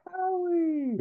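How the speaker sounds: very high singsong coo; delighted old flame. Acted. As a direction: imitate a woman cooing in falsetto. Old flame greets Howard on phone.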